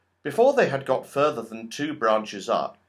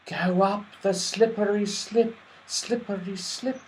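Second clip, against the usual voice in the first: sing-song the words like rustling leaves; leafy voices; 8 semitones higher than usual. A narrator's reading. Trees telling the children the way, in leafy voices. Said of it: "said the trees in their leafy voices".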